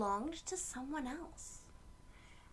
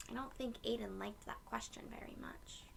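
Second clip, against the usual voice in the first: whisper; 8 dB quieter than usual. Real, not acted. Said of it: "whispered aside during the story".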